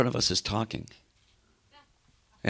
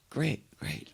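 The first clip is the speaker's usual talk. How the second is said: much softer, dreamy and far away; spaced-out murmur; 4 semitones higher, 11 dB quieter than usual. Retold or imitated. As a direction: mumble it like you're somewhere else. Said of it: "And he'd go".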